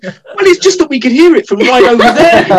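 high-pitched mock polite complainer; very English polite disapproval. Neighbour dodging whether they like bagpipes, mock English politeness. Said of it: "in a very English way".

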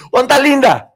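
high, loud sing-song question; peekaboo call. Pretend. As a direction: ask like playing peekaboo with a child. Peekaboo game with Linda.

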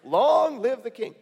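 butter up the king, overdone; hammed-up flattery. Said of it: "this is Nehemiah saying".

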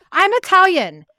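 loud, high, hammed-up Italian accent. Claiming Italian roots to her cohost, hammed-up Italian accent. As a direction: belt it out like a cartoon Italian. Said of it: "that's not a terrible accent".

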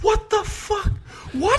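high, stunned outburst; shocked disbelief. Real, not acted. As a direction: squeal it in disbelief. Shocked by autocomplete result.

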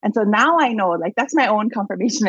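ordinary talk, nothing marked

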